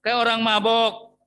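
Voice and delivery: priest scolding. Retold or imitated. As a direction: challenge her like a suspicious priest. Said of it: "Kata Eli".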